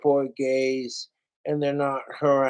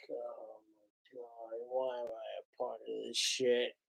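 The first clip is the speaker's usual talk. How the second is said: imitating Sam Elliott. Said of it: "he's just like".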